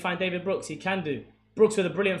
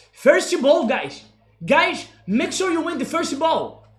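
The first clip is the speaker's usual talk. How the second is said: much higher, louder shouted call to the team; 11 semitones higher, 10 dB louder, slower than usual. Retold or imitated. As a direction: yell the instruction at the players. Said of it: "That's what Alex just said".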